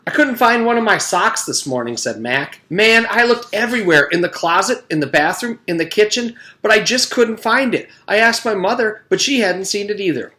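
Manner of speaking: put-on high, loud eager little boy; eager kid. A narrator's reading. Mac tells the class about his lost sock, high eager kid voice. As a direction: rattle it off like an excited kid.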